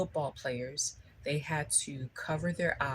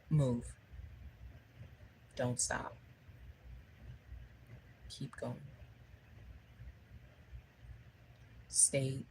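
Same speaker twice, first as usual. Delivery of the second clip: lightly voiced coach, soft and spaced out; steady, quiet prompts with long pauses; coach's voice; 2 semitones lower, 11 dB quieter than usual. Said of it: "the voice kept on saying".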